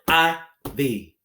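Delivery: "I-V" as two beats with a pause; syllables pounded out. Shown as a demonstration. Teacher pounding a word for pupils, syllables apart. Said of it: "Pound it".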